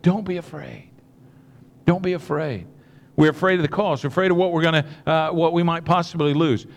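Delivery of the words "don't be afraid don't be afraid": softer, gentle comfort; soft reassurance. Retold or imitated. Jesus comforting the fearful, softly. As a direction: hush their fear gently. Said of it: "So Jesus says".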